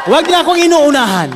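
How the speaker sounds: high, laughing through the quoted line; laughing mock quote. Pastor retelling the visitor's words, laughing. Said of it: "laughs, retold joke".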